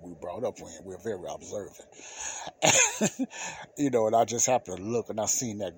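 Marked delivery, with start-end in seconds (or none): 2.0-3.7 high laugh. Real, not acted. Laughing at his own boast.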